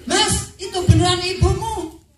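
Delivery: shocked wife. Acted. Wife shocked, shouting question.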